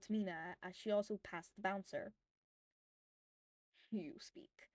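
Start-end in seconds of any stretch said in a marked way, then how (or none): 3.9-4.7 whispered. A narrator's reading. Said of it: "he whispered to her".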